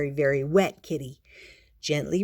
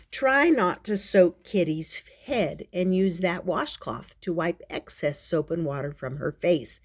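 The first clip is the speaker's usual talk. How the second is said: ordinary delivery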